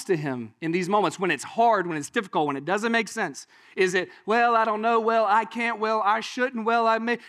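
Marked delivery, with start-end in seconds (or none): 4.3-7.2 mildly hammed-up excuses, pitched higher; mock reluctant excuse-maker; making excuses. Churchgoer dodging God's command, making excuses. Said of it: "you got excuses".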